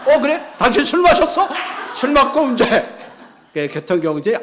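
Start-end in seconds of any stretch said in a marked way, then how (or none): 0.1-2.8 high, loud acted policeman's reaction; hammed-up incredulous questioning; mock astonished accusation. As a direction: pounce on the culprit, voice shooting up. Policeman pounces on drunk driving.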